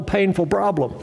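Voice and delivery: usual speaking voice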